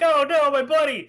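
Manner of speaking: loud, high dramatic lament; mock dismay. Player pitted against his new buddy, mock-distraught cry. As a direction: wail it in hammed-up dismay.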